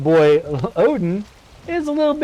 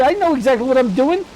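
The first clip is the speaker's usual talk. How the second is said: high, hammed-up huffy insistence; mock offended; 9 semitones higher than usual. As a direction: play the wounded storyteller. Playful rant at interrupting friend.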